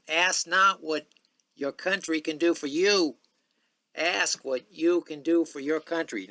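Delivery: rousing presidential address voice; Kennedy impression. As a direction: proclaim it like JFK at the inauguration. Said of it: "not very good Kennedy imitation".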